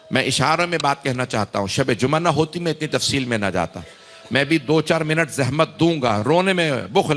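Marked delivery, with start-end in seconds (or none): none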